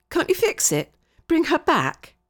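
high, anxious begging; urgent, high-pitched appeal; pleading. Acted. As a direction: beg them to put it right. Child begs for angel's return.

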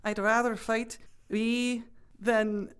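put-on halting, beginner's Scottish accent, slow and laughing; a nervous first try at a Scottish accent; attempted Scottish accent. Host reading a film line in a shaky Scottish accent. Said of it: "We'll go for the long ooh".

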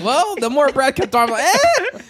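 high, rising to a squeaky eh; a squeaky, turned-off eh; unimpressed eh. Retold or imitated.

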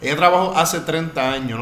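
"hace" marcado, frase lenta y enfática; recalcando "hace". Shown as a demonstration. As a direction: corrige despacio, subrayando "hace treinta años". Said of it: "no trabajó treinta años con él". (pronuncia "hace" con énfasis, más despacio)

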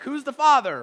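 loud, high mock TV-show demand; talk-show outburst. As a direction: blare it like a paternity-show reveal. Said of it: "like, Maury, Maury level".

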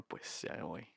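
murmullo sin voz, resignado; Harry resignado, susurro. Retold or imitated. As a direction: se va en voz baja. Harry se rinde. (Harry se despide del profe en susurro)

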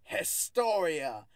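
loud, slow, drawn-out proclamation; hammed-up regal roar, every word stretched; mock mighty roar. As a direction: proclaim it like a newly risen queen. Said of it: "with a mighty roar and s-, claimed".

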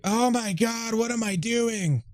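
high, slow mock horror; horrified. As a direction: wail it like a shocked victim. Horrified victim quoted.

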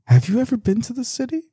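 mock disbelief back at the critics; incredulous retort. Retorting to critics about driving.

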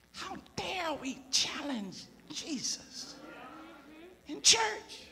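very soft, slow rhetorical question; hushed reproof. Real, not acted. Preacher asking how we dare challenge Jesus, hushed. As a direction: let it fall almost to nothing.

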